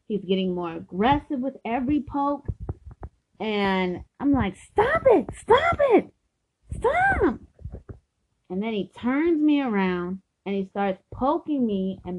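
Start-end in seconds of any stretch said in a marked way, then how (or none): 4.6-7.4 high, sharp cries to stop; acted protest. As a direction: re-enact a loud, high-pitched plea to quit. Acts out protest at being poked.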